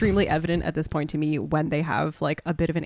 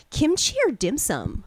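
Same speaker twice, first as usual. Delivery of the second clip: put-on slow, higher, oblivious old man; clueless old guy voice; 9 semitones higher than usual.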